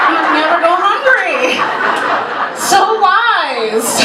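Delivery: high, drawn-out gleeful proclamation; mock triumph. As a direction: crow it as a comic punchline. Mock triumph after weed punchline.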